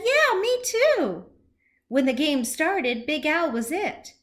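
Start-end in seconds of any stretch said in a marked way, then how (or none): 0.0-1.2 high, slow squeaky-small voice; high and slow, eager to agree; little fish voice. Acted. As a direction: play a tiny shrimp chiming in. Shrimpy's line, small fish.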